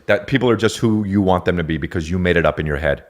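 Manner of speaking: ordinary talk, nothing marked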